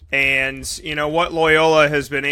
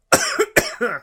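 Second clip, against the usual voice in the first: harsh choking cough; 9 semitones higher than usual. Real, not acted. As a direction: cough it out hard. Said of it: "Choke it out of the shell".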